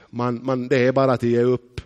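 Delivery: normal talking voice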